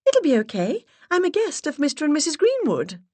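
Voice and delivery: sweet high-pitched put-on voice. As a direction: push the voice way up and play the charming visitor. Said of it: "raised my voice an octave".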